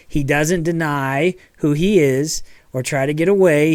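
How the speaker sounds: usual speaking voice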